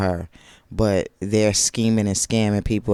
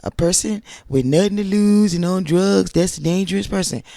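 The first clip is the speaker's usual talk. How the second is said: higher, louder mock-serious quote; mocking Tisha's copied line; 9 semitones higher, 5 dB louder than usual. Vlogger mocking Tisha repeating Tiffany, higher and louder. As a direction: parrot the line back with a sneer, pitched up. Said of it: "what Tiffany said".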